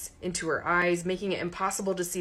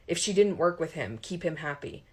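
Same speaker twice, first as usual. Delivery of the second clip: ordinary delivery, nothing marked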